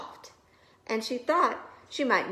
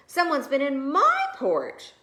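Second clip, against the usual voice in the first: higher, loud indignant mother bear; a touch of outrage; Mama Bear; 7 semitones higher, 6 dB louder than usual. A narrator's reading. Mama Bear finding her porridge touched, loud indignant voice. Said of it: "said Mama Bear".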